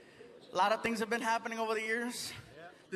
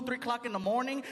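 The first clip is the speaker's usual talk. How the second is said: ordinary talk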